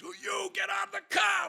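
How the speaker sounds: high, loud imitated police shout; yelling cop. Cop ordering the driver out, yelling. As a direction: shout the order like an angry officer. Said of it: "he's t-, yelling, screaming at them".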